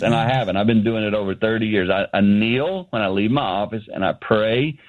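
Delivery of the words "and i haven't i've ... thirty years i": ordinary talk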